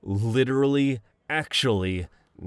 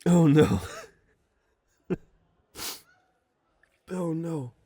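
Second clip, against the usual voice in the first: choking up; 8 semitones higher than usual. Real, not acted. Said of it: "Keep it together".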